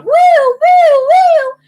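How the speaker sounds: very high, loud imitated ambulance siren; hysterical siren wail. Imitating the ambulance arriving, hysterical siren noise. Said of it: "they're, like, hysterical".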